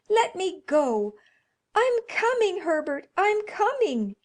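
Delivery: high, loud, desperate calling; frantic cry. Acted. Mother struggling loose and crying out to her son. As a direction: break free and call to him. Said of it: "she cried, struggling".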